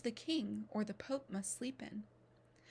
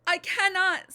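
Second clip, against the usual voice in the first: high, loud panicked protest; frightened cry; 10 semitones higher, 14 dB louder than usual. Acted. Scared apprentice refuses the laboring wife, high frightened cry. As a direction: blurt it out in terror. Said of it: "I'm sore afraid".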